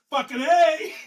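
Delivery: high, loud burst of eager yes; excited shout. Retold or imitated. Young Lance Corporal answers the board, loud excited shout. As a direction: blurt it out like a keen young Marine.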